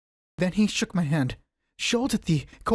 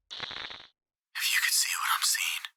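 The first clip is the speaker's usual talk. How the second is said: slow, hushed terrified whisper; shaky whisper; 6 dB quieter, slower than usual. A narrator's reading. Warren calling up from the vault, in a slow frightened whisper. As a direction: breathe it out, scared stiff. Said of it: "a shaky whisper more pretentious than the loudest shriek".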